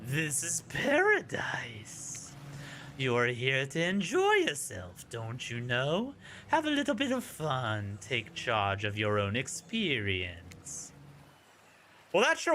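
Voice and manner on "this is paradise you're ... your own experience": whispery, distant voice of the sea; creepy talking ocean coaxing the lost player; eerie ocean voice. Acted. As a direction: lure them in like a sinister ocean. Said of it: "that voice again".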